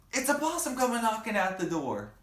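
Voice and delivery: high loud excited call; excited shout. Acted. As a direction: call it out again eagerly. Child repeats shout.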